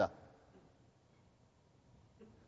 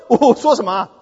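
ordinary talk

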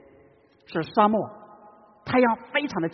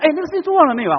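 wife nagging; 10 semitones higher, 8 dB louder, faster than usual. Retold or imitated. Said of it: "我太太讲我两声".

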